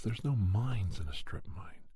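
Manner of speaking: ordinary delivery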